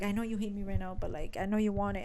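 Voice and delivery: low, soft-spoken captor; murmured persuasion, imitating the villain; quiet coaxing. Acting the seducing captor.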